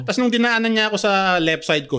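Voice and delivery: ordinary talk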